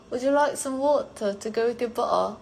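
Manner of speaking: put-on British accent. Shown as a demonstration. Streamer offering water as a school joke, mock British accent. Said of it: "say bottle of water in British accent".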